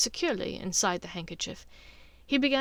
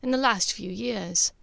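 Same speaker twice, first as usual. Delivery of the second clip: ordinary delivery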